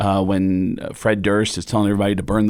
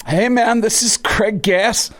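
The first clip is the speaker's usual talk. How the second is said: high, loose-paced impression of a rock drummer; hammed-up drummer pitched well above normal; 9 semitones higher than usual. Drummer showing off a comedian to his band, high-pitched impression. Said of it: "[name] introduced me to the rest".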